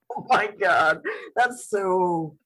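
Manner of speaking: ordinary delivery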